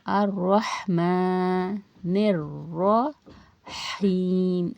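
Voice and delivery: slow, drawn-out Quranic recitation. Shown as a demonstration. Teacher recites the verse for students, slow and drawn out. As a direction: chant it slowly, stretching the vowels.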